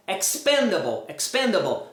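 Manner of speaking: "expendable" stressed on PEN; weak falling -able in "expendable"; correct pronunciation. Shown as a demonstration. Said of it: "nunca vai ser a sílaba tônica".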